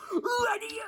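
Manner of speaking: high, loud startled cry; acted-out high-pitched panic; panicked shriek. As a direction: yelp as if something crawls in your hair. Acting out the spider panic.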